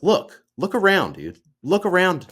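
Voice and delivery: loud, high, urgent retold command; insistent dad. Dad urging his apprentice to look, insistent.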